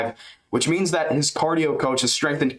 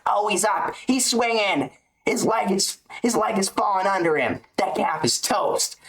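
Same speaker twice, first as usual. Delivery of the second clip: much higher, hyped commentator play-by-play; mock Rogan calling every leg kick in awe; Joe Rogan impression; 7 semitones higher than usual. Fan imitating a commentator calling a brutal calf-kick fight, much higher and hyped. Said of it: "he'd be like".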